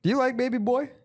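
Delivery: high sing-song teasing coo; mock cooing. Teasing his friend with a high baby-talk coo.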